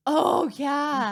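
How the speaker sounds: high, loud burst of remembering; sudden excited recognition. Real, not acted. Sister recalling the trailer story, excited outburst. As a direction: blurt it out as the memory hits.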